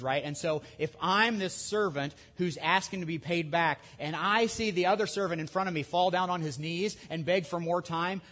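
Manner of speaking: usual speaking voice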